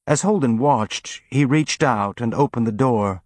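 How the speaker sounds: usual speaking voice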